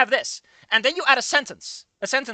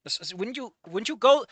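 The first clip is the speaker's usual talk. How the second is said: ordinary talk, nothing marked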